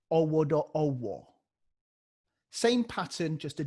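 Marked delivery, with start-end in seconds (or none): 0.1-1.3 rounded owodo owo, every vowel an o; beatbox vowel drill. Shown as a demonstration. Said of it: "And say".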